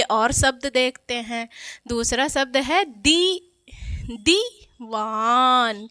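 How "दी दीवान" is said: Diwan said very slowly; drawn-out teaching word; slow sound-out. Shown as a demonstration. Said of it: "इसको धीरे से उच्चारित कर रहे हैं ना".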